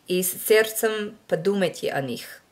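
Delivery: out-of-practice learner reading scripture in Russian; rusty Russian reading. Shown as a demonstration. Reading a Russian passage aloud for viewers in her rusty Russian. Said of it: "I don't even know if I'm doing the accent marks right".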